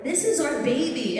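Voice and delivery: proud fan. Retold or imitated. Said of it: "And he's like".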